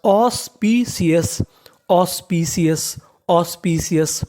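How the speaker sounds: teacher stretching "auspicious" out slowly; slow sounding out. Shown as a demonstration. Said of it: "तो meaning बनी".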